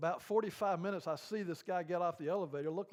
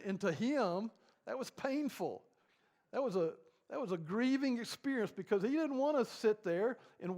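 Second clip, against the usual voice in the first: raised, higher preaching voice building on grief; impassioned preaching; 6 semitones higher, 5 dB louder than usual. Real, not acted. Preacher stressing a child's grief to the congregation, raised and impassioned. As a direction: press the point home to the congregation.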